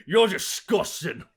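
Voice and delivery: slow loud after coughing; drunk raspy voice. Acted. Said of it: "drinks an entire goblet of wine and go".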